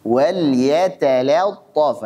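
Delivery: yatalattaf with every a thinned to e; overly thin reading. Shown as a demonstration. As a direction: yatalattaf stretched too thin, like jeteletof. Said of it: "Ini berlebihan".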